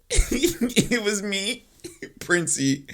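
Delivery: high, laughing delivery; cracking up while talking. Real, not acted. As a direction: squeeze the words out slowly through laughter. Cracks up telling funny story.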